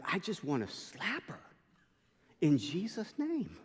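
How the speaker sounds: tag exasperated confession. Retold or imitated. Retelling a pastor's exasperated confession.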